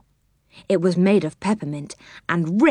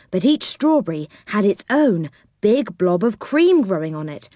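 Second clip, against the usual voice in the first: ordinary delivery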